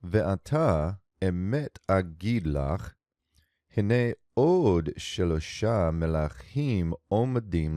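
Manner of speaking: slow, measured chanting of the verse; slow Hebrew scripture reading. Shown as a demonstration. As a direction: read the Hebrew text deliberately, word by word. Reads Hebrew verse slowly.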